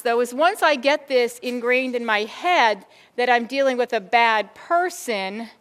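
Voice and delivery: normal talking voice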